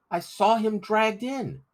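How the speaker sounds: put-on high, slow, earnest boy; a boy swearing he saw it; insistent boy's voice. Crispin insists to the doubting men, high and earnest.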